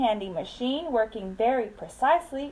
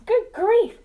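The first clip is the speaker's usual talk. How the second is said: hammed-up high, loud groan; 9 semitones higher, 6 dB louder than usual. A narrator's reading. Star-bellied Sneetches complaining in a mock groan, loud and high. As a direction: moan it in mock dismay. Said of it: "groaned the ones who had stars".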